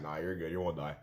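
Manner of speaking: low, soft dismissive reassurance; imitated casual paramedic, low and quiet; amused ambulance guy. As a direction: brush the worry off in a low voice. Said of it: "he laughs, he laughs".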